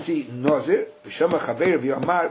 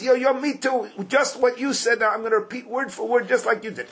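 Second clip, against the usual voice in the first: YO, YO, ME TOO pitched high and insistent; raised, insistent emphasis all through; emphatic; 7 semitones higher than usual. Acted. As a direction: spell it out emphatically in a much higher voice. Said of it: "spell out with emphasis".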